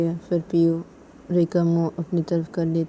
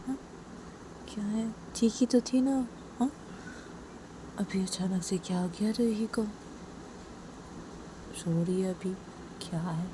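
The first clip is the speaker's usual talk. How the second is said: talking to herself; 3 semitones higher, 8 dB quieter than usual. A narrator's reading. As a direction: murmur it privately, worried about her. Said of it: "PU अपने में बोलती".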